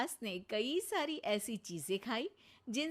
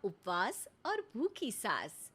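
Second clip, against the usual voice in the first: even storyteller voice introducing the scene; sketch narration. A narrator's reading. Narrator opening the family comedy sketch, storytelling. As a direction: set up the comic story plainly.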